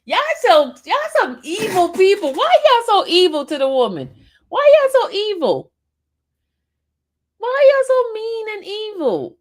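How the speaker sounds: mock outrage. Teasing chat about evil people.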